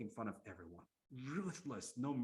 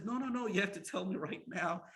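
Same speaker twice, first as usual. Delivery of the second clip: louder, higher urgent demand; insistent protest; 7 semitones higher, 6 dB louder than usual. Acted. King demands the rest of the story now, insistent protest. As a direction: cut in and insist, acting the impatient king. King refuses to let her stop.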